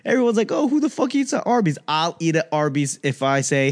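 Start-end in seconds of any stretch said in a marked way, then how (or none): 0.5-1.8 high-pitched mimicry of a scandalized crowd; incredulous, high mock protest; mocking scoffers. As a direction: imitate the doubters way up high. Mimicking everyone scoffing at Arby's.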